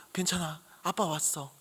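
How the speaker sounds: put-on soft, tender reassurance to a crying child; soothing the child. Father hugging his lost crying son, softly soothing. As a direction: hush him gently, close and soft.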